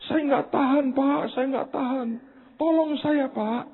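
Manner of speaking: high-pitched mock wailing; imitated crying plea. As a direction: act out a desperate caller begging in tears. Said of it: "Dia nangis". (menirukan rengekan minta tolong)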